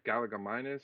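tentative second try at Galligaminus, rising; unsure mispronunciation. Real, not acted. Podcaster retrying the name for his co-host, Galligaminus as gal-uh-guh-MY-nus, tentative. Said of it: "I'm not saying it right".

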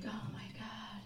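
breathless terrified whisper; panicked whisper. Retold or imitated. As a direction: whisper it in panic, barely audible. Panicking as boyfriend returns.

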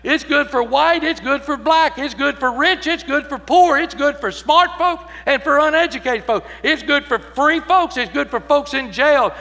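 loud, high, ringing sermon climax; full-force preaching. Acted. Preacher proclaiming good news to the congregation, full-force preaching. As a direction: preach it out to the whole church.

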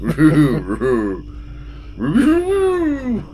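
high, slow cartoon dog voice; Scooby-Doo voice. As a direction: stretch it like Scooby. Imitating Scooby-Doo speech.

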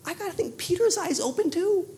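ordinary talk

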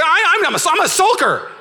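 high, loud stammering protest; hammed-up flustered shriek; mock screaming. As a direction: imitate a wife yelling her denial. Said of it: "she's, like, screaming at me".

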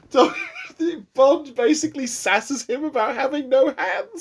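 high-pitched, breaking into real laughter; cracking up. Real, not acted. Telling co-host how Bond sasses Doctor No, cracking up. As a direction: struggle to tell it through giggles. Cracking up while retelling film line.

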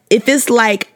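ordinary talk, nothing marked

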